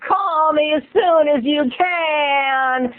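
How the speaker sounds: high, slow voicemail imitation; imitating Laura. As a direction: draw it out high and sweet on the machine. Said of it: "a message on my answering machine".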